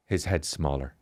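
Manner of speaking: soft, low confiding delivery; imitating a gravely worried wife. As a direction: break the bad news quietly and low. Wife's grave announcement retold.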